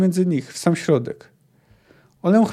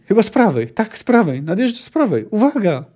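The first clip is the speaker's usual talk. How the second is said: high, urgent warning; alarmed, raised alert; shouted warning; 6 semitones higher, 4 dB louder than usual. A narrator's reading. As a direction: call out the danger as it nears. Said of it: "krzyknął Puszczyk".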